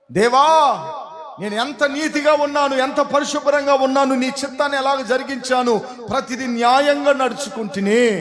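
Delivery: high, loud acted plea to God; preacher's impassioned imitation of weeping prayer; retold tearful prayer. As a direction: cry out as if praying through tears. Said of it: "కన్నీళ్లు విడుస్తా ప్రార్థన చేస్తాడు".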